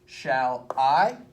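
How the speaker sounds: "Shall I" with a loud, drawn-out, rising I; iambic stress. Shown as a demonstration. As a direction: lean hard on the "I" in "Shall I". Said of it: "We're gonna cut it into feet".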